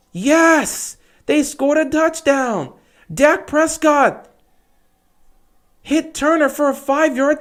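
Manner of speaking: high, slow, elated announcing; thrilled. Real, not acted. Fan telling the guys Dallas scored, high and thrilled. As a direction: tell them the news like you can't believe it.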